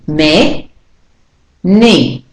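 "Me, ni" split apart, both vowels full; vowels said clearly. Shown as a demonstration. Teacher breaking many into syllables, each clearly sounded. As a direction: say "Me", pause, then "ni", equally stressed. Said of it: "It's saying e clearly".

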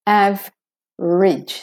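"Average" slowly as AV-ridge; two-syllable average. Shown as a demonstration. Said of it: "from a V to an R".

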